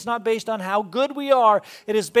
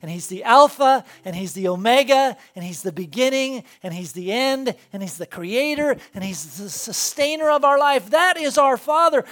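louder and higher, building sermon fervor; preaching at full force; 5 semitones higher, 6 dB louder than usual. Acted. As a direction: preach it with rising conviction. Preaching climax about God.

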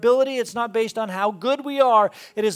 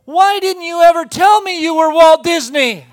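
put-on high, loud, accusing child's cry; little girl confronting her dad, loud and indignant; 9 semitones higher, 14 dB louder than usual. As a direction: burst out like a kid who's just found out.

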